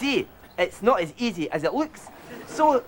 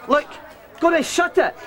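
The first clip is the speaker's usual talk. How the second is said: sharp yell pitched way up; angry outburst; 8 semitones higher than usual. Acted. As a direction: snap at the crew to shut it. Snapping at crew off camera.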